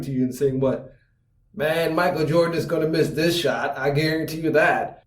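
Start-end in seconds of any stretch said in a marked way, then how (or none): 1.5-5.0 mildly bitter hater; grudging prediction with a touch of bitterness. Acted. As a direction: say it like you can't stand the player. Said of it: "with that bitter voice".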